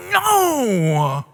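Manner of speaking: put-on sulky little-kid no; pouting no. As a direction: pout the word out like a toddler. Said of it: "he's pouting".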